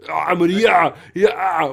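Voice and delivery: loud lightly voiced Arnold; a hint of the old Arnold; Will Sasso's Schwarzenegger. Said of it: "Will Sasso is like".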